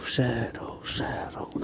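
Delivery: "shadow" whispered, fading to nothing. Pretend. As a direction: let each "shadow" die away as a whisper. Echoing shadow fading away.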